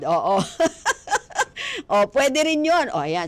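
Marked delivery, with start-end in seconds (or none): 0.4-1.8 high peal of laughter; amused laugh. Real, not acted. Host laughs at own senior joke.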